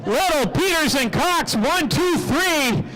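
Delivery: high-energy track announcer, pitch climbing as the lead changes; live race play-by-play. Real, not acted. Track announcer calling laps and passes for the crowd, excited play-by-play. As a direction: call the race with full announcer projection.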